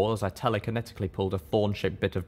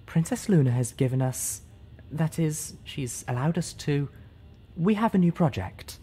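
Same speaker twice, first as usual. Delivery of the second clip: timid, much higher, halting speech voice; a shy pony stumbling through a speech; Fluttershy; 7 semitones higher than usual. Acted. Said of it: "her inflection perfectly matching Fluttershy's".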